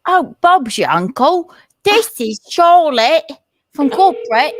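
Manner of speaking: loud, high put-on Cockney accent; hammed-up Cockney prank caller; mock Cockney accent. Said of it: "Call England with an accent?".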